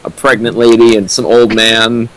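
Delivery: ordinary talk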